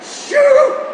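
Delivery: a sharp high yelp of surprise; sudden exclamation. Acted. Sees the loose wires.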